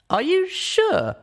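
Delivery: high, slow and unsure; high hesitant mare voice. Acted. Pip asking, high and slow.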